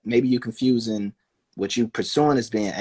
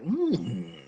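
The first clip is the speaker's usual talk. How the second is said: much higher, slow and louder, hammed-up intrigue; mock curious voice; 6 dB louder, slower than usual. Kid discovering curling online, slow hammed-up high curious voice. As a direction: play the rare kid who's hooked, savour it. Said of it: "five percent that's like".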